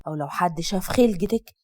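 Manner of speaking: father gripping his daughter's hand, warning her. Acted. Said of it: "بتحذير".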